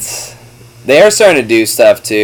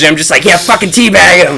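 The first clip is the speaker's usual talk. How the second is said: wife mimicking him; 9 semitones higher, 5 dB louder than usual. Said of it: "she's like".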